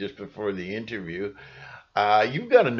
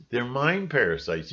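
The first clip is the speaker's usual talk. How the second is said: normal talking voice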